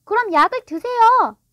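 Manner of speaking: put-on high, loud little-boy voice; challenging child. A narrator's reading. Small son daring his father to drink, challenging. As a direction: dare him as a pushy small boy.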